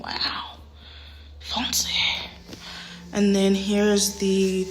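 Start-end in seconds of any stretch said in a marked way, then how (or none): 0.0-1.9 hushed, awed whisper. Real, not acted. Showing viewers the fancy shower, an awed whisper. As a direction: breathe it out in awe.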